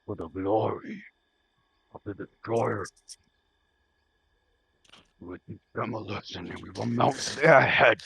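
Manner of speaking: put-on guttural Liverpool accent, dragged out; deep guttural Liverpool voice. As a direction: lizard warlord growling in a Liverpool accent. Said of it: "really deep, guttural, freaking Liverpool voice".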